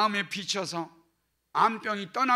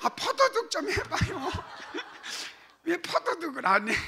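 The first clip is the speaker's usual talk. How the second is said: laughing, higher, teasing; laughing plea; 8 semitones higher than usual. Real, not acted. Pastor laughs, urging the congregation to flap like wings.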